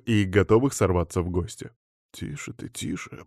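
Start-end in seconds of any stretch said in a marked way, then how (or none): 2.1-3.2 whispered, pale-faced plea for calm; frightened hush. A narrator's reading. Guest calming the threatening witch, frightened hush. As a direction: hush someone urgently under your breath. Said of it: "побледнев, произнес гость".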